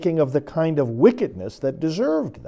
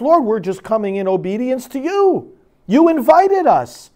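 pleading protest; 6 semitones higher, 4 dB louder than usual. Pretend. Voicing the people protesting to God.

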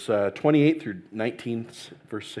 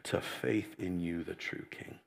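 hushed pastoral prayer, trailing off softly; 7 semitones lower, 14 dB quieter than usual. Real, not acted. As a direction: pray gently, almost under the breath. Pastor prays before the sermon.

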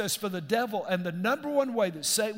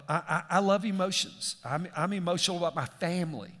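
normal talking voice

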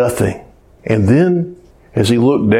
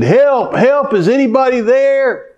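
high, desperate cry of help; hammed-up yell from a man hanging off a cliff; frantic mock call for help, pitched high; mock scream for help; 11 semitones higher than usual. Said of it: "And so he screamed".

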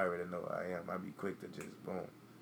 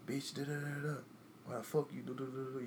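mock angry rant; 6 semitones higher than usual. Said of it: "if I call you and".

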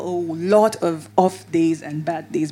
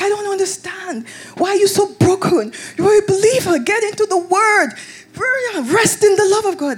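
imitating dad; 10 semitones higher than usual. Voicing dad's brisk advice.

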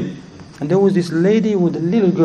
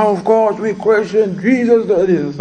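lightly voiced teenagers, pitched up; retold teenagers' reply; 6 semitones higher than usual. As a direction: imitate kids answering as if it's obvious. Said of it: "They said".

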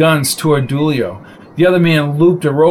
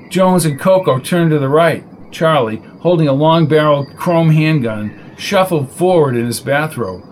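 usual speaking voice

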